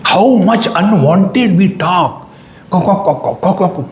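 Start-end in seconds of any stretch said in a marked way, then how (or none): 2.7-3.9 mock hen clucks for chatter; high, staccato imitation of cackling hens; poultry-farm clucking. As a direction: cluck like a gossiping henhouse. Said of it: "poultry farm".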